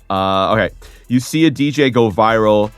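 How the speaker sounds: usual speaking voice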